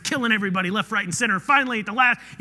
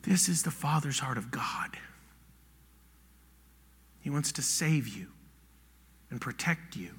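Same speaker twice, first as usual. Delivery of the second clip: hushed whisper; 8 semitones lower, 10 dB quieter than usual. Real, not acted. Preacher whispers to the congregation about God's heart. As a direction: whisper it, barely voiced. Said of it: "preacher drops to a whisper".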